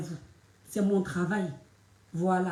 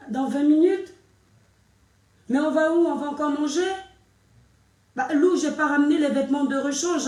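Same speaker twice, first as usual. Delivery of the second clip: surprised protest; 6 semitones higher, 5 dB louder than usual. Real, not acted. Surprised at sudden outing.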